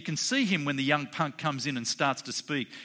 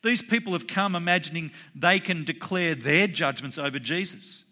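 normal talking voice

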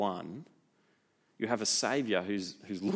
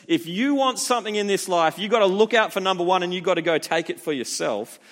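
higher, louder, pushy voice of the world; mock worldly voice; 6 semitones higher, 7 dB louder than usual. Preacher mocking the world's selfish motto, brash. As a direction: sell selfishness like a brash go-getter.

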